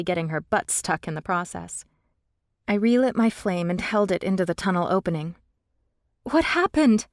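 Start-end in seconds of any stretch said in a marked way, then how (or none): 6.2-7.1 high, loud breathless question; breathless worried question; rushed breath. A narrator's reading. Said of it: "I asked in a rushed breath".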